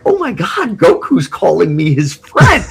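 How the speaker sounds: giddy retold disbelief; starstruck gush. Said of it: "And I was like".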